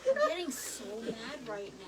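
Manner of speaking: usual speaking voice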